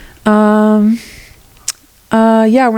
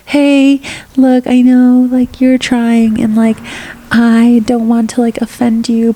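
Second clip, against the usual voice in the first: mock timid sugarcoating; 5 semitones higher than usual. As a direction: tiptoe around it nervously. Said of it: "Not like".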